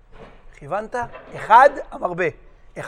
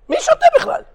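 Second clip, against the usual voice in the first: high mock voice; 3 dB louder, faster than usual. Mocking question.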